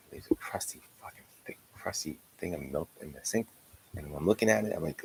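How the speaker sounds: usual speaking voice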